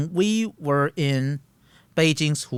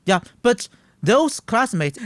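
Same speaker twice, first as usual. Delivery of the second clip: ordinary talk, nothing marked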